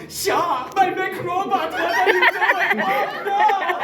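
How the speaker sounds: high, loud laughing robot cry; over-the-top mech robot. Retold or imitated. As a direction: ham up the disco robot. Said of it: "this man goes".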